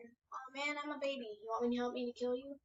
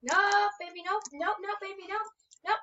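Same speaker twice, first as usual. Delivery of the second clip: high frantic repeated no; panicked pleading; 4 semitones higher, 8 dB louder than usual. Real, not acted. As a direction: beg frantically, rising to a yell. Chased by killer in game.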